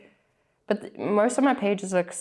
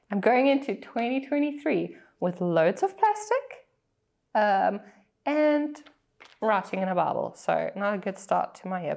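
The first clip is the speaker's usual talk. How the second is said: normal talking voice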